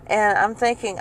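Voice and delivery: normal talking voice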